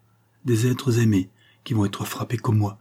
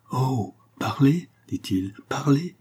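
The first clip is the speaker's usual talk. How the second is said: slow, higher, urgent plea; higher, slow plea, last word whispered; pleading; 5 semitones higher, slower than usual. A narrator's reading. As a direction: beg her to speak, slowly. Marquis pleads, breathless hope.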